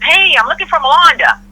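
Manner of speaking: a hint of an eager caller at the front desk; imitating the customer. Guy asks for her at lobby.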